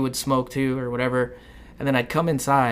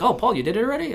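mock surprised classmate; 10 semitones higher, 3 dB louder, faster than usual.

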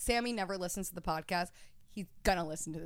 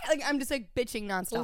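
ordinary delivery

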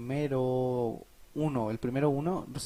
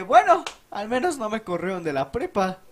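relieved laugh; 7 semitones higher, 7 dB louder than usual. Retold or imitated. Relieved after fearing expulsion.

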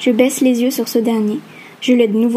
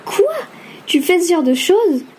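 higher, squawking mock alarm; shrill voice; 7 semitones higher than usual. Friend squeals back at the threat, high shrill mock alarm. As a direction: shriek it back in fake shock. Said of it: "d'une voix criarde".

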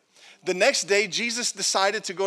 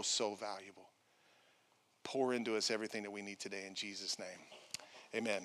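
hushed pastoral prayer; 7 semitones lower, 7 dB quieter than usual. Real, not acted. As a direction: pray quietly and sincerely. Closing prayer.

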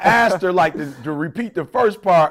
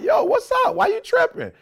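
much higher mock-surprised voice; high mock surprise to wife; surprised retold reply. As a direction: play innocent, pitched way up. Retells own surprised reply.

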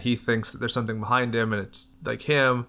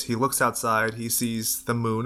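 usual speaking voice

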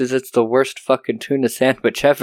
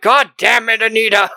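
exasperated husband; 8 semitones higher, 4 dB louder than usual. Retold or imitated. Husband snaps at wife.

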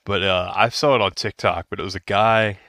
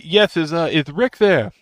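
imitating the assistant; 8 semitones higher, 6 dB louder than usual. Assistant answers the phone.

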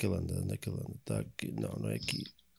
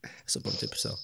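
whispering; 8 dB quieter, faster than usual. Real, not acted. Host aside during quiz.

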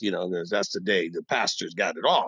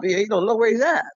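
ordinary delivery

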